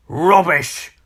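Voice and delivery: scornful storybook grump; sharp retort. A narrator's reading. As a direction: dismiss it crossly. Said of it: "retorted Mister Grumpy".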